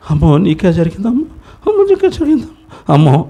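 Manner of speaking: high, wailing cry of grief; mock wailing. Preacher mocking mourners he says not to imitate, mock wailing. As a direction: ham up a mourner's lament. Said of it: "అని అనకూడదు".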